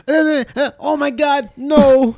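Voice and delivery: hammed-up tearful wail; mock crying. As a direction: imitate a kid crying in panic. Retold Vine kid in distress.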